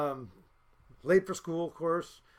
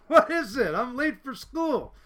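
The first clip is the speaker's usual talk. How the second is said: high, loud mock protest; protesting student; 6 semitones higher, 6 dB louder, slower than usual. Late student to cop in the parking lot, mock protesting. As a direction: call back with a laugh in it.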